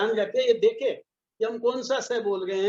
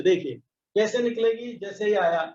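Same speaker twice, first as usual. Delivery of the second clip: ordinary talk, nothing marked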